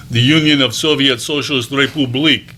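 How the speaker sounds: put-on a light, classroom-joke Russian accent on the words; lightly mock Russian accent, as he did in class. To classmates, a playful mock Russian accent. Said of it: "the only Russian accent I had done".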